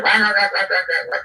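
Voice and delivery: high mock bellow, rah rah rah; boisterous boss bellowing. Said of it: "he heard Menachem from around the corner going".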